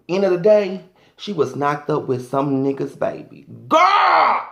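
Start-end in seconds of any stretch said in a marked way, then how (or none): 3.7-4.4 sudden high call to the crowd; high exclaim. Real, not acted. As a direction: shriek it out at the crowd. Exclaiming to the audience.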